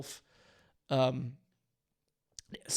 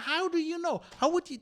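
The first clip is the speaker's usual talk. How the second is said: much higher, louder disbelieving challenge; mock-incredulous reporter pressing back; incredulous questioning; 11 semitones higher, 4 dB louder than usual. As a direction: demand proof, almost yelling. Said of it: "You go like".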